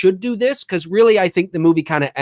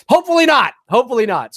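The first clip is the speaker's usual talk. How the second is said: higher, louder hopeful plea; 6 semitones higher, 4 dB louder, faster than usual. Real, not acted. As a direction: lift it with hope. Hoping the idea lands.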